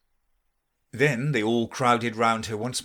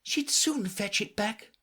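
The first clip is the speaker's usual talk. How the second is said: Alice voice; 10 semitones higher than usual. A narrator's reading.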